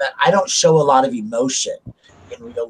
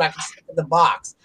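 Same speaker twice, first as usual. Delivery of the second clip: normal talking voice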